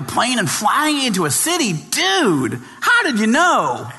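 a lightly voiced laid-back surfer-dude host, drawn out; imitating the radio host. Radio host grilling the author on air, lightly voiced surfer dude. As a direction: toss off each "dude" like an amazed stoner DJ.

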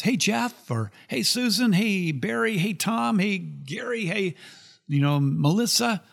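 bright high hellos; friendly greetings. Retold or imitated. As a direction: call out names cheerfully. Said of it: "comes up to you and says".

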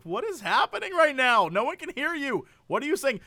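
high, incredulous questioning; mock exasperation. Diner who cannot understand the announcer, high and exasperated. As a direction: protest in comic bewilderment.

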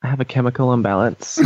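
put-on airy Valley girl whine; mock the way a Valley girl talks. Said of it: "Starting to sound like a Valley girl".

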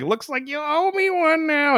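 high, smug sprite voice; cocky. Retold or imitated. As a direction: gloat with a smug grin. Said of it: "he's like".

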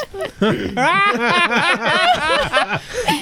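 long, high-pitched laughing fit. Real, not acted. Reader cracks up at the email, high laugh.

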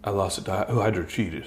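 low, soft wrestler confession; Razorblade. Acted. Fallen rollerball champ telling his story, low solemn voice. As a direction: play a fallen champ looking back, low and quiet. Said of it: "Razor Blade, after he lost his".

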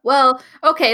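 ordinary talk

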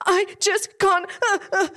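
high-pitched, gasping mock crying, words broken up; imitated hyperventilating sobs. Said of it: "that hyperventilating stage of crying".

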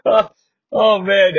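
ordinary talk, nothing marked

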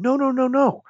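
imitating the inner whiner complaining about his limits; whiny inner voice. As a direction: whine like the voice that gives up. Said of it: "the whiner's going".

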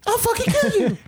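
high hammed-up threat; mock battle cry. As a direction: shriek it like a cartoon brawler.

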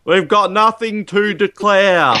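high, slow, drawn-out Bond impression; George Lazenby. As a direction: stretch it out high, hammed-up.

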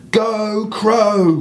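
high, loud cheer for the crow; yelled cheer. Retold or imitated. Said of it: "we yelled".

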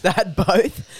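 giggling through the words; laughing. Real, not acted. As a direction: laugh as you say it. Laughing repeat of friend's words.

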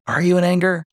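high, held-up indirect question; lightly mocked passive-aggressive asking, pitched high. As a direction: imitate a pointedly indirect query. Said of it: "passive aggressive or indirect".

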